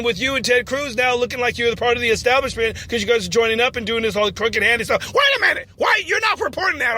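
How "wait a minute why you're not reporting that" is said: mock outrage. Candidate outraged at reporter.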